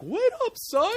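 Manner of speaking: very high, comic calling out after his son; goofy dad yell. Retold or imitated. Dad commandeers a car to chase his son, very high comic yell. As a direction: squeak it out like a hapless dad in pursuit. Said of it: "picture Kevin James".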